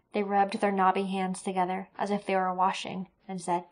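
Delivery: ordinary delivery, nothing marked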